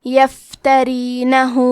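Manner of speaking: یفترینہ with the final ہ stretched two counts; final vowel lengthened. Shown as a demonstration. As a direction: draw out the end of یفترینہ. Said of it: "آواز دو مد کے برابر لمبی کریں گے".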